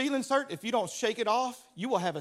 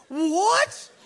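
high, loud astonished yelp; mock shock; 8 semitones higher, 7 dB louder than usual. Preacher hearing of two broken feet, loud shocked yelp. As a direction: blurt it out in shock. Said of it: "I'm like".

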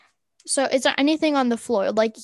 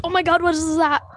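higher-pitched fake shock; feigned alarm; 5 semitones higher than usual. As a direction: pretend to spot something startling, as a distraction. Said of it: "I'm gonna be like".

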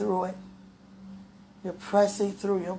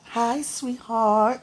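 playful mock sexy; 3 semitones higher, much slower than usual. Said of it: "I'm gonna make sure I sound sexy".

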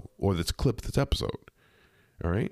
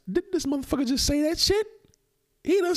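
high, incredulous outburst; can you believe this, up high; outraged that she asked again; exasperated disbelief; faster than usual. Real, not acted. Outraged at the repeated question.

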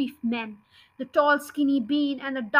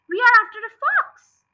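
shouting back; 8 semitones higher, 8 dB louder than usual. Acted. Farmer shouting back up to crowd.

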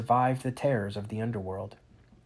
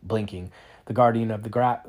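usual speaking voice

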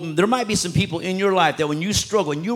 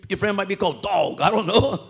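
normal talking voice

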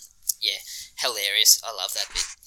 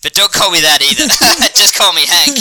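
high, loud quote broken by laughter; can barely get the line out for laughing; laughing while quoting; 6 semitones higher, 8 dB louder than usual. Retold or imitated. Fan quoting Hank's name correction, laughing through it. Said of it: "Hank's correction, laughing through it".

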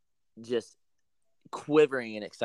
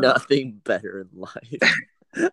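slow mock echo; 4 dB louder, much slower than usual. Echoes the ad slowly.

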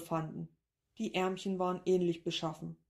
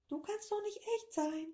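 much higher, softer squeaky echo; high creature echo; 5 dB quieter than usual. Acted. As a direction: parrot it back in a tiny creature voice. Creature echoes the professor.

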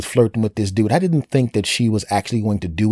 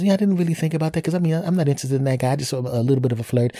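put-on high, fast apologetic girl voice; girlfriend excusing herself; 6 semitones higher, faster than usual. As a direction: rattle off a sheepish excuse. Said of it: "And she goes".

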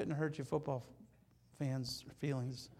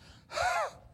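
gasp. Acted. Acted shock gasp.

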